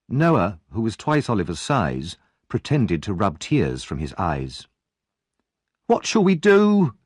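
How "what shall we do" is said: high, loud and slow frightened woman; alarmed cry. Acted. Missus Sowerby cries out in alarm about Oliver, high and slow. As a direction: wail it in a panic. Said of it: "cried Missus Sowerby".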